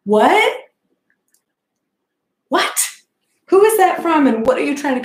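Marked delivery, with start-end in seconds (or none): 0.0-2.9 sharp, high, loud "what" with a rise; baffled what. Real, not acted. Reacting to the strange text, loud and baffled. 3.5-4.3 much higher and louder demand; can't believe what she's reading; incredulous. Real, not acted. Asking the phone who sent it, high and incredulous. Asking about mystery text.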